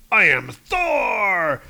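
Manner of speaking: slow, loud, grand declaration; booming god proclamation. Acted. Dargo declares he is Thor, slow and loud. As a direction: announce your godhood to the world.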